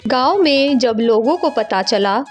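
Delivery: ordinary delivery, nothing marked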